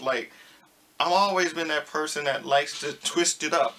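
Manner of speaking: ordinary talk, nothing marked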